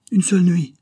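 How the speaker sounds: police chief insisting on one night with Zara; leering insistence. A narrator's reading. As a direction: press her with a nasty grin. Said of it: "avec une détestable grimace en guise de sourire".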